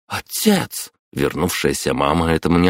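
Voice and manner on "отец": high sharp reproach; reproachful cry. Acted. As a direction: call him out sharply. Мама застаёт деда с картами. (укоризненный окрик)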